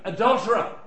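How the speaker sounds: high accusing single word; mock accusation. Preacher naming a sin at the congregation, high accusing. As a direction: fling the word out as a charge. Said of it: "I'm not pointing to anyone".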